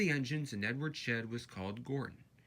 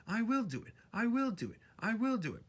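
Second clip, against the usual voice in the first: high, determined chant; determined little engine; 11 semitones higher, 4 dB louder than usual. A narrator's reading. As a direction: chug it out with effort. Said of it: "puffed Edward".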